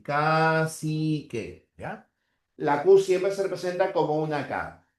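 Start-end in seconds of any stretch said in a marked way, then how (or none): 0.0-1.7 Cacique very slow, both c as k, stress on ci; slow dictation. Shown as a demonstration. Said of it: "sería así".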